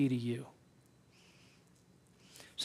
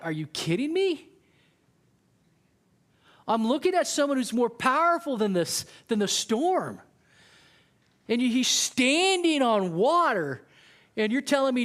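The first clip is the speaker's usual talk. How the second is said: higher, louder, can't believe it; incredulous disbelief; 5 semitones higher, 3 dB louder than usual. Acted. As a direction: balk at what you're hearing. Disciples react in disbelief to Jesus.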